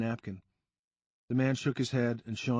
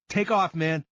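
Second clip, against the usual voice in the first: louder, higher warning yell; 5 semitones higher, 6 dB louder than usual. A narrator's reading. A friend yelling to Sean before the punch, loud. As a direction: shout the warning across the lot. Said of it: "someone yelled".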